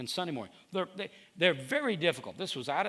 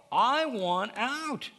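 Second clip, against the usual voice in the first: pleading; 6 semitones higher, 5 dB louder, much slower than usual. Acted. Preacher voicing a pleading prayer.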